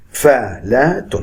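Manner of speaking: فالات said very slowly, three long beats; drawn-out syllables. Shown as a demonstration. Teacher stretching فالات to show three long syllables. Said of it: "طويل طويل طويل".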